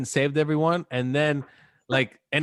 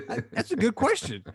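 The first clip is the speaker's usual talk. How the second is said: unsure mock Superman; 8 semitones higher, 4 dB quieter than usual. Superman stumped by Batman's question, mock unsure.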